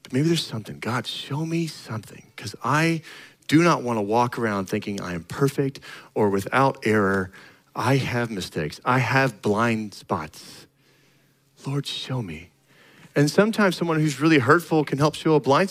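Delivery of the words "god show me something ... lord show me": much softer, slow humble plea; a near whisper to God; hushed prayer. Real, not acted. Pastor praying to God about his blind spots, hushed.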